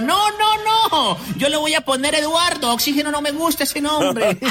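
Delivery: grito muy agudo de papá nervioso; papá asustado gritando. Acted. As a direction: chilla la negativa como papá asustado. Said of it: "gritó y dijo". (papá asustado chillando muy agudo)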